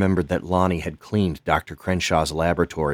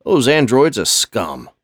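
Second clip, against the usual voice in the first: higher, louder scorn; contemptuous old custodian; 6 semitones higher, 6 dB louder than usual. Acted. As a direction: spit the word scum. Lonnie spits contempt.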